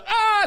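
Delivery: loud, high wail; anguished scream. Retold or imitated. Him wailing to the comedians, loud and high. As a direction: let out a despairing shout.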